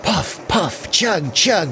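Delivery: chug it out like a toy train; little engine puffing along; engine noise. A narrator's reading. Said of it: "went the little blue engine".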